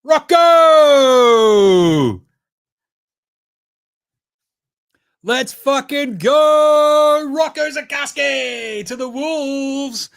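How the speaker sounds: long, high, loud cheer, drawn out slow; real overjoyed yell at the TV; ecstatic shout. Real, not acted. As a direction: roar it like your team just drafted your guy. His pick announced live.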